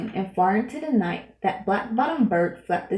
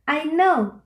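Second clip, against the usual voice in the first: called out; 7 semitones higher, 3 dB louder than usual. A narrator's reading. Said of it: "called the voice".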